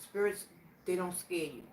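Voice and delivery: normal talking voice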